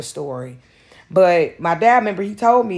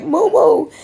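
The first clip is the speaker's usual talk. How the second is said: high pleading stepmom voice; embarrassed stepmom; 11 semitones higher, 5 dB louder than usual. Retold or imitated. Stepmom at home begging dad to act, high pleading. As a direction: beg daddy to do something, high and urgent. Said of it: "my stepmom was so embarrassed".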